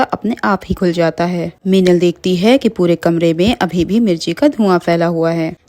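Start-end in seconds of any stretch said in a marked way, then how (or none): none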